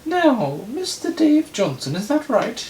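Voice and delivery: very high falsetto judge; put-on piping high voice for a judge; Rachel Lloyd. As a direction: address the court in loud falsetto. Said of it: "Rachel Lloyd".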